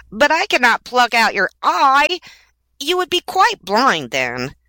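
high-pitched little bird objecting; distressed swallow protest. A narrator's reading. Swallow refusing to take the prince's eye, distressed protest. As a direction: protest in a high voice, slowing at the end.

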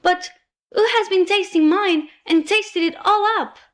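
small, high-pitched little bear in tears; teeny tiny bear voice. A narrator's reading. Poor little bear finds the food all gone, teeny tiny high voice. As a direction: pipe up high as the tiniest bear. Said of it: "in a teeny tiny voice".

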